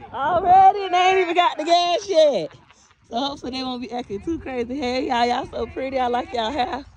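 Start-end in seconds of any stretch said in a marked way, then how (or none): 0.1-2.5 excited exclaim. Real, not acted.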